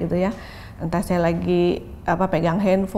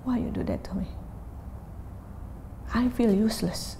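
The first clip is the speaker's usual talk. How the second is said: hurt whisper; 2 semitones higher, 7 dB quieter than usual. Retold or imitated. Child's hurt words voiced softly.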